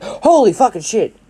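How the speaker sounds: high-pitched startled curse; shocked. Acted. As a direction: react as if someone just popped out. Students startled by Deku appearing.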